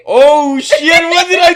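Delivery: high, loud panicked laugh-talk; laughing through mock panic. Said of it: "and I'm like".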